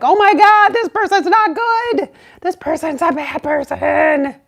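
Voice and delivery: high, wailing retold panic; hammed-up cry of alarm; frantic alarm. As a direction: start very loud and high, easing off. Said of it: "I was just, like, so in that space".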